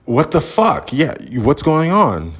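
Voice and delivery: high-pitched incredulous retort; retold exasperation. Imagined retort to staff at the crazy house, exasperated.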